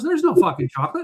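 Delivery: high indignant lady voice. Retold or imitated. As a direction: protest the rude word. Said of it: "She goes".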